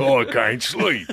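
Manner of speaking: slow, put-on British villager voice; British game villager. Villager complaining about the night noise, slow mock British accent. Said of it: "a blacksmith in The Witcher".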